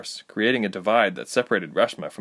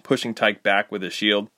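plain reading voice

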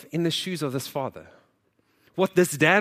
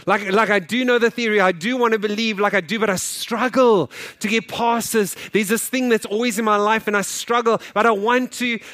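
raised, louder pleading voice; 6 semitones higher, 6 dB louder than usual. Pretend. Pastor voicing the congregation's struggle, pleading loudly. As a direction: push through the struggle, voice raised.

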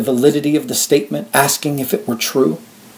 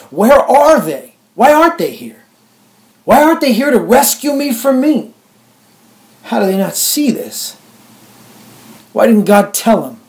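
high, loud anguished cries, then fading; desperate pleading; 9 semitones higher, 3 dB louder than usual. Acted. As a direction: cry out to the sky in despair. Desperate questions into the sky.

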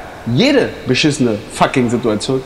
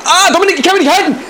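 panicked falling cry. Acted. Climber losing grip on the liana calls to Dominik, panicked screaming. Swinging over abyss, falls.

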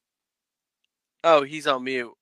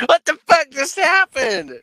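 surprised shout; 12 semitones higher, 5 dB louder than usual. Real, not acted. Confused after mute mix-up.

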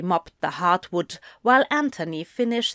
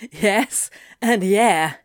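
laughing; 5 dB louder, slower than usual. A narrator's reading. Demon confirms his angel's orders to his counterpart, laughing. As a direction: chuckle first, then an amused confirmation. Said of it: "Anthony laughed".

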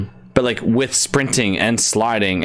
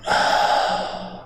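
slow audible breath out; long exhale. Pretend. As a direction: let the air out in a long, soothing sigh. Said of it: "breathe out".

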